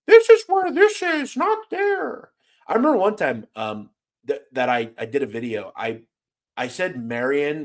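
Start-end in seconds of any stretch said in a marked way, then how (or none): 0.1-2.3 high, prissy mock know-it-all correction; mock pronunciation police. Streamer mocking a nitpicky commenter, high mock voice. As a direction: mimic the nitpicky commenter, pitched way up. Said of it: "the people that are like".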